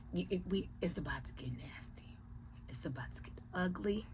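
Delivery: whispered warning that it's getting nasty; menacing whisper. Real, not acted. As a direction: hush it like a dark secret. Whispered threat about getting nasty.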